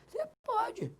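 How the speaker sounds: teacher answers his own question in a squeaky high voice; mock answer voice. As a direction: pop up in a high little voice to answer yourself.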